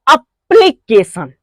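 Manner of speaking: Application slow, as अप-प्ली-के-शन, leaning on के; stressed by syllable. Shown as a demonstration. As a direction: break up application with weight on के. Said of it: "इस word पर impress जमेगा". (Application को धीरे तोड़कर, के पर ज़ोर)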